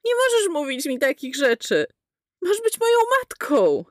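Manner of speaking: put-on high boy's voice climbing very high; horrified and disgusted boy. A narrator's reading. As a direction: cry out, appalled. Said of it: "z mieszaniną przerażenia i obrzydzenia".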